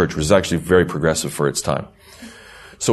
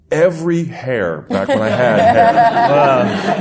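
laughing; 9 semitones higher, much slower than usual. Real, not acted. Recalling the fright, laughing.

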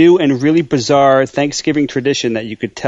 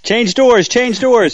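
eager urging; 8 semitones higher, slower than usual. Acted. Urging the Monty Hall switch.

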